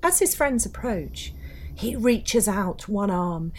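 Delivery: dark storyteller voice, building tension; ghost story narration. A narrator's reading. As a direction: tell it like a campfire ghost story.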